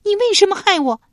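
shrill, high, loud cry of pain and accusation; 捂着脸尖声质问; screaming demand. Acted. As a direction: scream it at the old maid, hurt and furious. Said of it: "捂着脸朝老宫女质问道".

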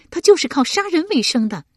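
高声急切地顶回去; 又急又气，像跺着脚说; 跺脚着急. A narrator's reading. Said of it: "佩娘跺脚道". (跺脚着急地喊)

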